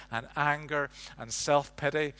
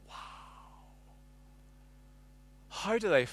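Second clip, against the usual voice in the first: awed wonder; 5 dB quieter than usual. Retold or imitated. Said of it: "it's just a sense of".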